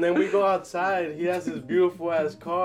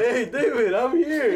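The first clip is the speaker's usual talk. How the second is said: retold calling out; 7 semitones higher, 4 dB louder than usual. Retold phone call calling to friend.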